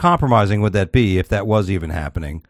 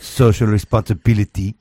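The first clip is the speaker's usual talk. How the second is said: mock Russian accent; hammed-up Russian pronunciation; Putin. Host finishing Putin's quote, mock Russian accent. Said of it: "This is the best accent".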